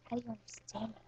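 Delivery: unvoiced whisper. Kid whispering a question to the cat. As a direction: ask it softly, secretive.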